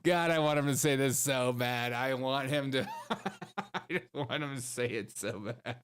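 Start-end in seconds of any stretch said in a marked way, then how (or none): none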